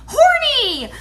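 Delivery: high, loud drawn-out scream; little girl's scream. Retold or imitated. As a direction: scream it out like an excited child. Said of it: "and she screams".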